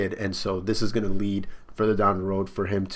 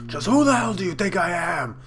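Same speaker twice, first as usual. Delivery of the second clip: put-on higher, strained drunken roar; raging drunk Kaido; 8 semitones higher than usual. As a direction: bellow it like a furious drunk pirate.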